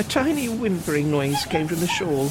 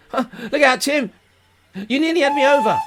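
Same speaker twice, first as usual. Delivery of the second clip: laughing; 8 semitones higher, 5 dB louder than usual. Acted. Laughing as dog bumps him.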